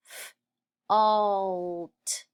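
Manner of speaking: first sound alone, then the rest drawn out. Shown as a demonstration. Teacher sounding out fault for pupils, sounded out. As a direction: split "f-, alt" into f, pause, long alt.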